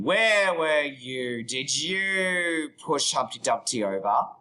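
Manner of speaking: slow, drawn-out accusing question; mock interrogation. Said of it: "I'm gonna be asking you".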